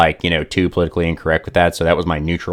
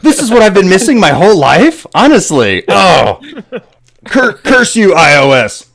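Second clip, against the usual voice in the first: much higher and louder, a hammed-up gasp of discovery; hammed-up wonder turning into a mock curse; mock-amazed friend; 13 semitones higher, 7 dB louder than usual. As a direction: play a friend overwhelmed by his new phone, over the top. Friend imitated discovering Android.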